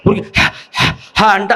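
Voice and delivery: sharp voiceless breaths out, హ, హ; breath demo. Shown as a demonstration. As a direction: push the air out in short puffs. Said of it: "ప్రాణవాయువుని వెడల్పు చేయి".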